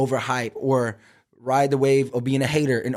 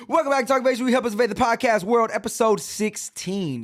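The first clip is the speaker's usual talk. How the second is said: high, hyped announcer voice; hyped podcast intro; 10 semitones higher than usual. Acted. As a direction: pump up the intro like a host. Redoes intro hyped, headphones on.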